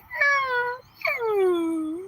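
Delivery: very high whimpering cries; dog whine imitation. Puppy seeing her owner cry, high whimpers. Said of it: "she was like".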